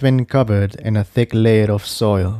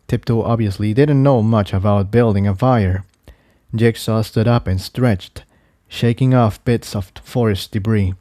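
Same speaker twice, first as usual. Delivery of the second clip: usual speaking voice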